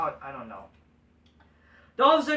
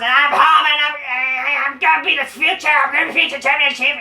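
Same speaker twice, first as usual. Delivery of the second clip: loud, high mocking rant; over-the-top mock promo shouting, much higher; Seth Rollins; 5 semitones higher, 10 dB louder than usual. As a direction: yell it out as a hammed-up wrestler impression. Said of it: "Seth Rollins".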